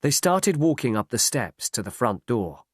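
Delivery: ordinary delivery, nothing marked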